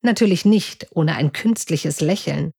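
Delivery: ordinary delivery, nothing marked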